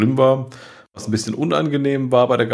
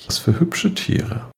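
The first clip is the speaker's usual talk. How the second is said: whispering; 9 semitones higher, slower than usual. Acted. Admiring fish, whispered.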